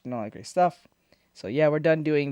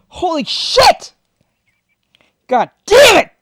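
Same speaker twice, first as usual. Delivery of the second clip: slow build to a high shout; shocked cursing; 13 semitones higher, 10 dB louder, slower than usual. Real, not acted. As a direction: curse loudly, exploding on the last word. Startled by a gory clip.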